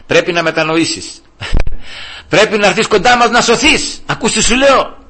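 imitating a scornful boss ordering repentance; boss's preachy taunt. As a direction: lord it over him with jeering insistence. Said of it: "μου λέει".

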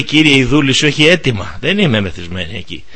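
bow your head and answer meekly; humbly. Retold or imitated. Anna humbly answering the priest Eli, soft and low. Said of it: "Ταπεινά".

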